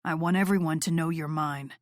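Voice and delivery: low, possessive murmur. Acted. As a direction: claim her, low and close. Noah voiced lower.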